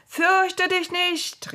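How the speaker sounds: high, drawn-out call; sustained high-pitched shout. A narrator's reading. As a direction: call across a distance, slowly. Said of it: "riefen sie ihr zu".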